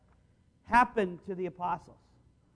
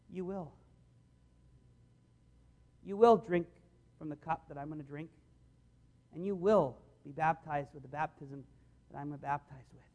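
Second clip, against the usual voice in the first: tender Jesus; 7 semitones lower, 7 dB quieter than usual. Acted. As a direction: lower and much softer, a gentle loving promise. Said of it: "you can just see Jesus' heart for them".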